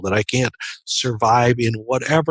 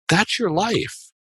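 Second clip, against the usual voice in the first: slow, higher, tender "That's your life"; gentle reveal; 7 semitones higher, much slower than usual. Acted. God reveals the tapestry.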